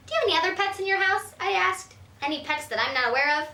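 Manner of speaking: pry with quick curiosity; curious little girl. Acted. A hint of a girl quizzing grandma about her pets.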